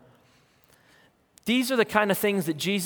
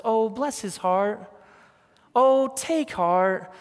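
sweet Southern woman; 5 semitones higher, slower than usual. Retold or imitated. Pastor imitating a sweet Southern woman, slow Southern drawl. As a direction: coo it like a sweet Southern lady. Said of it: "that sweet Southern woman that says".

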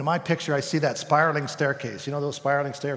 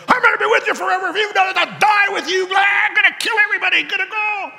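much higher, louder boastful shouting; boastful Peter; 7 dB louder, faster than usual. Acted. Simon Peter boasting to Jesus, loud high shout. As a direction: shout it like a rash hothead. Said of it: "Simon Peter just made this big declaration".

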